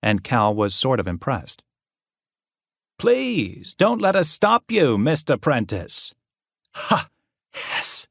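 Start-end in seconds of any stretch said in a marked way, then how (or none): 3.0-6.1 high, mocking politeness; theatrical mock courtesy; sarcastic taunt. A narrator's reading. Professor taunting student. 6.7-8.1 hissed. A narrator's reading. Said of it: "Yokver hissed".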